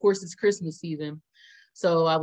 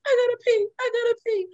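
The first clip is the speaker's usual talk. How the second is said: urgent pee plea; 4 dB louder, faster than usual. Retold or imitated. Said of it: "I said".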